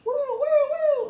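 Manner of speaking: high mock police siren wail; siren imitation. Storyteller mimics police cars swarming the neighborhood, high siren wail. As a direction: mimic the cop sirens blaring.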